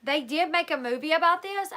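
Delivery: ordinary talk, nothing marked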